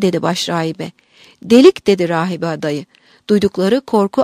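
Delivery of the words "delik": raised one-word exclamation; loud echo. A narrator's reading. Frightened novice answering the abbess, loud exclamation. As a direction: throw the word back loudly, higher.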